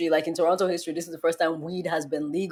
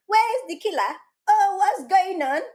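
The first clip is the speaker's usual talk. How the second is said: clueless bystander; 13 semitones higher than usual. Acted. Mocking people who don't run from danger, high clueless voice. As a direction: play oblivious curiosity, pitched way up. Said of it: "We don't stand and be like".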